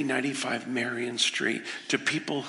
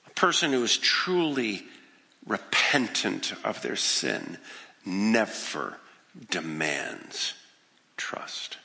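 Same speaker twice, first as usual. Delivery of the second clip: lower, slower, dropping to nearly a whisper on "trust"; slow emphatic point; 5 semitones lower, slower than usual. Acted. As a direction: land each word, "never demands trust".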